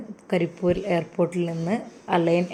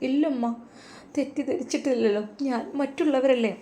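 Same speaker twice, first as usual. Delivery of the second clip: put-on higher, gentle girl's reply; young woman's voice; 6 semitones higher, 3 dB quieter than usual. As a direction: answer softly in the girl's higher voice. Character voice held. (പെൺകുട്ടിയുടെ മൃദുവായ മറുപടി)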